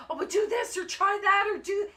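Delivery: high, loud panicked backseat instructions; imitated frantic screaming. Husband hovering during dog rescue, imitated frantic shouting. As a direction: shout over the rescuer's shoulder, mimicking. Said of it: "someone screaming over your".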